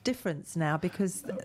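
ordinary talk, nothing marked

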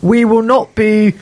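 lightly voiced stuffy official; mock official voice. Mocking the council head's statement.

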